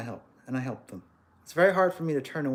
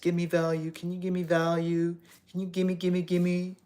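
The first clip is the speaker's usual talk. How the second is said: whiny begging; 3 semitones higher than usual. Retold or imitated. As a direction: whine it like a grabby stranger. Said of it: "someone who asks like that".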